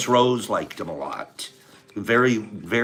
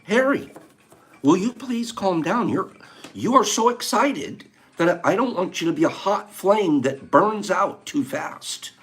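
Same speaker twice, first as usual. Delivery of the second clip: retold advice; 7 semitones higher than usual. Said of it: "I told Harry".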